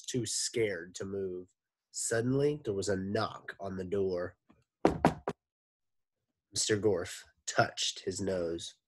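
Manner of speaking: ordinary delivery, nothing marked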